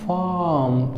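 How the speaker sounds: फार्म as slow "faaam"; stretched फार्म with no r sound; long vowel, silent r. Shown as a demonstration. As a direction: hold the vowel in फार्म, drop the r. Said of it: "वो लोग फार्म बोलते हैं".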